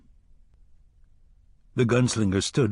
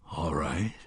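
much softer, low murmur; softly; 7 semitones lower, 8 dB quieter than usual. A narrator's reading. Gunslinger quietly accepting the jawbone, soft. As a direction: say it almost to himself. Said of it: "he said softly".

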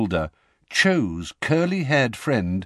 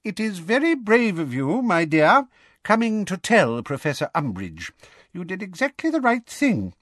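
high, would-be kindly voice; high-pitched paternal praise; Fudge, fatherly; 9 semitones higher than usual. A narrator's reading. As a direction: coax a frightened girl with pompous kindness. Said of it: "imagined was a kind and fatherly".

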